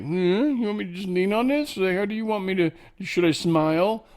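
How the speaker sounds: high, lightly voiced pet asking how to pose; posing dog voice. Dog asks photographer how to pose, high playful voice.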